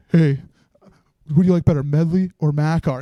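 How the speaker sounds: annoying younger self. Retold or imitated. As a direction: butt in loudly, imitating your old self. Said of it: "I'm so annoying".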